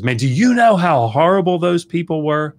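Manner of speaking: hammed-up indignant apologist; mocking apologists. Scholar mocking apologists defending genocide, indignant mock voice. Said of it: "coming out of their mouths is".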